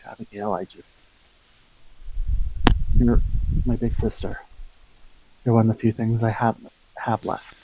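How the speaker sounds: hushed, whispering, sincere; whispered apology. Acted. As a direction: confess it softly to your sister. Apology to estranged big sister.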